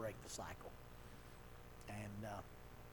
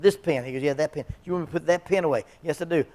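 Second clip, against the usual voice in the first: louder playful volley; teasing back-and-forth; 3 semitones higher, 12 dB louder, faster than usual. Retold or imitated. Counselor and client trading pen lines, louder playful banter. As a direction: bounce the lines back quickly. Rapid pen demo exchange.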